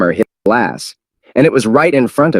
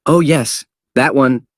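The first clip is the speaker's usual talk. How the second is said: happy; 5 semitones higher, slower than usual. Acted. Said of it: "said happily".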